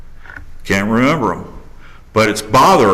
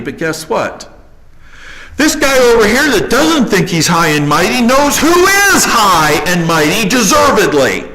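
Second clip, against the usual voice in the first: high, loud pulpit rebuke; full-force preaching; 11 semitones higher, 3 dB louder than usual. Acted. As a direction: scold the proud like a preacher.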